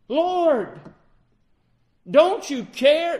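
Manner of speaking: loud, high, slow cry for help in a storm; desperate cry. Acted. Frightened disciples cry to the sleeping Lord, loud and desperate. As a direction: call out over the wind, pleading.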